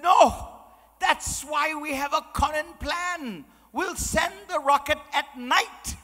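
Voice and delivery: put-on high, emphatic comic Irish accent, loud opening then slowing. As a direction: retort as a triumphant joke Irishman. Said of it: "So the Irish fella".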